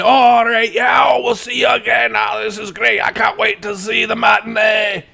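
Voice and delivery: put-on high, loud drunken cheering; rowdy drunks cheering a departing bard; drunken crowd shouting. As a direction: bellow it like a happy drunk.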